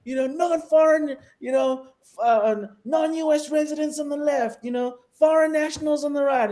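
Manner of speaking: high, shouted mock queue orders; US border officer. US airport officer sorting residents from foreign nationals, shouted mock orders. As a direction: imitate an officer calling commands down a long line. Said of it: "in the U S, it's like".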